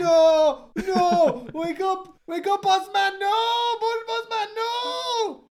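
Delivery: wailing grief. Acted. Grieving character wails over king.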